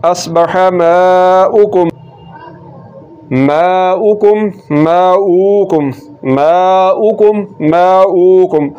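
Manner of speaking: माउकुम recited very slowly, short u on the hamza; माउकुम धीमी तिलावत; slow recitation. Shown as a demonstration. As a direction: chant माउकुम slowly, every syllable clear. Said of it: "Hamza के ऊपर पेश है, माउकुम पढ़ना है".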